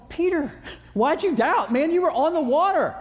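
a hint of Jesus chiding Peter; Jesus, gently exasperated. Jesus chiding Peter for doubting, lightly voiced. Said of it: "I see Jesus going".

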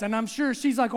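normal talking voice

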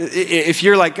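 ordinary talk

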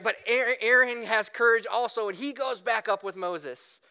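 ordinary talk, nothing marked

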